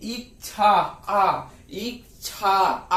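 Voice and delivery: इच्छा drawn out very slowly, the final आ stretched long; teacher sounding out इच्छा to isolate the आ; slow syllable demo. Shown as a demonstration. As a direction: say इच्छा slowly, holding the आ at the end. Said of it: "एक बार अपने से आप उच्चारण कीजिएगा".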